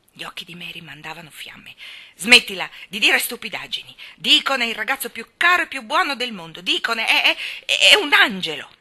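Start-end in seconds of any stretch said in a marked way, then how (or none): 2.2-8.7 loud, higher, stammering on "è"; indignant, groping for the word; furious defence. Acted. Mary defends Deacon as an angel, furious.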